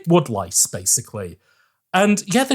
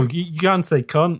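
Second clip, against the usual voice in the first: ordinary talk, nothing marked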